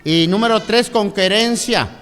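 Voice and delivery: loud, high sermon delivery, counting out points; preacher building up to full force; preaching. Acted. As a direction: drive each point home to the congregation. Preaching three points of discipline.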